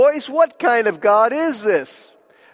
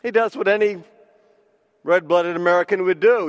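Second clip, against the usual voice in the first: ordinary delivery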